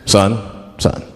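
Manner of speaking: low, stern cop voice; police officer. Acted. Cop at a traffic stop, low mock-official voice. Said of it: "the police pull me over".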